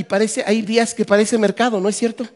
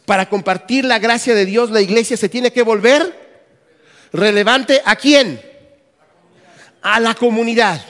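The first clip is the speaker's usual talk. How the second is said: loud, raised preacher's voice; emphatic preaching; 3 semitones higher, 7 dB louder than usual. Real, not acted. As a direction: drive the point home to the congregation, loudly. Preacher drives main point to congregation. (predicación enfática, a viva voz)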